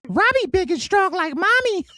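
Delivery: put-on very high, cooing toddler talk; baby voice. Baby admiring his buff brother, very high cooing toddler. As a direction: gush like a tiny kid. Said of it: "baby praising his brother".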